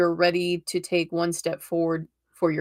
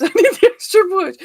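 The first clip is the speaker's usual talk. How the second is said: laughing; 13 semitones higher, 10 dB louder than usual. Real, not acted. Laughing at her own confession.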